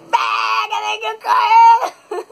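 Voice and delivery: high, drawn-out re-enacted shout; gritando. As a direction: yell the alarm to stir up a crowd. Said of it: "Aí eu comecei a gritar". (grito agudo e esticado, reencenado)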